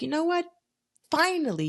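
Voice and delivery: high pitched, exasperated relief; relieved exclamation. Retold or imitated. Finally getting the apology she waited for, high exasperated relief. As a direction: throw it up high like it's long overdue. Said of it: "and you're like".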